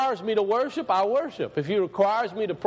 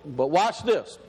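ordinary talk

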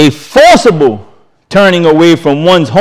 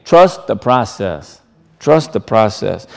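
gentle urging; 6 semitones lower, 5 dB quieter, slower than usual. Real, not acted. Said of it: "repeated exhortation, dropping lower".

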